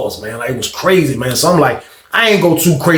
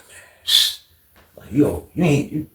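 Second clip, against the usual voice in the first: hushed whisper; 3 semitones lower, 7 dB quieter, slower than usual. Retold or imitated. Hushing, asking about the play.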